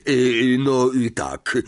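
loud, drawn-out hesitant fillers; awkward hedging. A narrator's reading. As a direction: stall before admitting it, dropping at the end. Lockhart caught packing, hedging.

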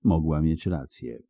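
ordinary delivery

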